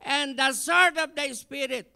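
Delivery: rising, loud preacher cadence; building sermon proclamation; preaching at full force. Acted. Preaching the armor list.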